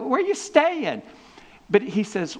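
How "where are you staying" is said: high-pitched, wide-eyed mimicking of where are you staying; preacher imitating disciples' awkward question; mock naive question. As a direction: ask it like a clueless follower. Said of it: "they go".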